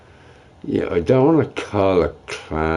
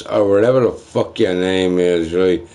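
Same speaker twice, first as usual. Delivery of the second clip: usual speaking voice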